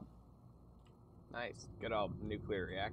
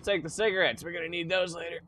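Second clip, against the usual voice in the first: excited; 7 semitones higher, 12 dB louder than usual. Real, not acted. Looting excitedly.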